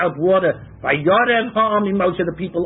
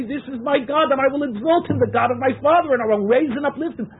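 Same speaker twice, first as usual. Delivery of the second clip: higher, fervent declaration of faith; exalted praise; 7 semitones higher than usual. Acted. Teacher voices Israelites singing at the sea, fervent and higher. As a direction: proclaim it with awe. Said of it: "were able to point and say".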